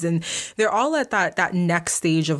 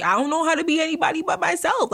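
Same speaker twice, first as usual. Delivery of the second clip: raised pitch, emphatic; high emphatic; 10 semitones higher, faster than usual. Real, not acted. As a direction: push it up high with conviction. Insisting she is genuine.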